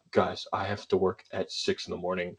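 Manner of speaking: imitating a tired roommate, low and soft; weary mild complaint. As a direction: quietly plead with noisy housemates, retold. Roommate's late-night complaint, retold.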